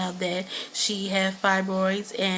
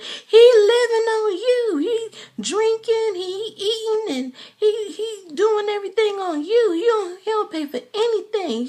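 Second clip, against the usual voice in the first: mimicking Candy's mother; 13 semitones higher, 5 dB louder than usual. Vlogger imitates Candy's mother complaining about Todd, high and whiny. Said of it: "he was just like".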